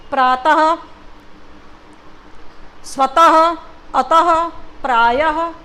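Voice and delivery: प्रातः, स्वतः, अतः, प्रायः each ending in a loud "ha"; sounded h ending. Shown as a demonstration. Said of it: "आपको ह सुनाई दे रहा है ना बोलते समय".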